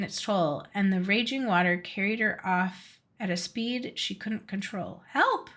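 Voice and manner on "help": a sudden high shout for help; calling for help. Acted. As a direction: beaver swept off by the river, crying out high for help. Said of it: "she called".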